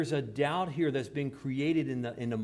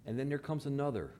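ordinary talk

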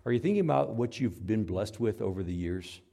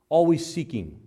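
louder repeat; 5 semitones higher, 7 dB louder than usual. Shown as a demonstration.

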